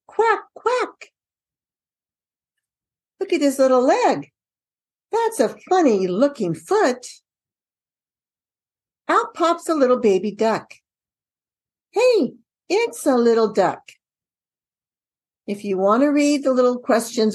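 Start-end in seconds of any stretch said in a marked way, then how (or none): 0.1-1.1 high squeaky quack quack; duck quack. Shown as a demonstration. Duck sound in picture book. 3.2-13.9 put-on lively storybook voice for a child; reading to a toddler. Shown as a demonstration. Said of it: "I would go like this".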